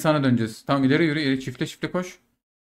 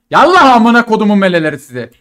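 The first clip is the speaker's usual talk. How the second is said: battle cry; 3 semitones higher, 6 dB louder than usual. Real, not acted. Charging into enemy.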